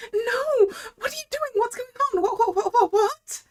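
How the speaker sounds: high, frantic character voice; flabbergasted friend. Acted. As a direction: sputter in amazement, pitched well up. Voices astonished friend in game.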